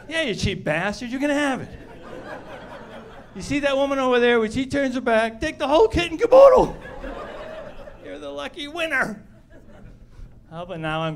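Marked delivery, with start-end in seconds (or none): 0.1-9.2 mock exasperated seller.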